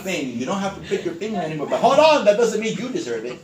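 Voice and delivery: rising to a loud, high, mocking pitch; mock sales call. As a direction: ham up a snooty caller. Can you imagine us calling our client.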